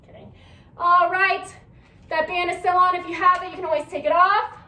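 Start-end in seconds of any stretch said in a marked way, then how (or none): 0.0-0.3 breathy laugh, then a soft kidding; soft laugh before saying kidding; laughing aside. Real, not acted. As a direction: laugh it off quietly. Laughs off her own joke.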